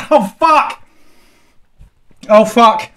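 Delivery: loud, high cry of burning pain; yelling as the heat hits; sudden shouted curse in agony; real pained shout. Real, not acted. Pain from the hot sauce.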